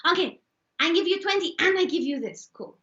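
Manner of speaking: high-pitched Chinese-accented market haggler. Shown as a demonstration. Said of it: "Chinese. You gotta do Chinese".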